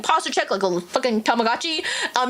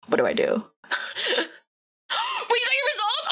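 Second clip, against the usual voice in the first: usual speaking voice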